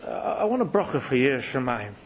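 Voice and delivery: low, hesitant stammer; mildly awed, halting plea; retold trembling request. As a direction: ask timidly, stumbling on the first word. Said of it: "I'm shaking".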